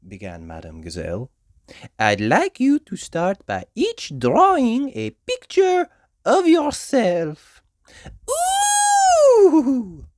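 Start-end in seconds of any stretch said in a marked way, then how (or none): 2.0-7.6 high, slow, gentle French teacher; Madame Gazelle teacher voice. A narrator's reading. 8.3-10.1 very high, loud squeal of delight; a thrilled children's ooh; excited cry. A narrator's reading. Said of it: "cried Peppa and her friends".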